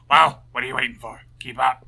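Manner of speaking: loud, bossy guard shout; impatient guard. Acted. Guard hurries the lawyer along the corridor, loud and bossy. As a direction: hurry them along like a gruff jailer.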